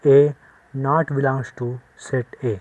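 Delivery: slow, drawn-out reading aloud; slow model reading. Shown as a demonstration. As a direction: show the class how it is read. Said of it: "ऐसे पढ़ सकते हैं".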